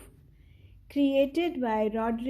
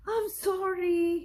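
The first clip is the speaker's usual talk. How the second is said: upset apology; 7 semitones higher than usual. Acted. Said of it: "Wilf was very upset".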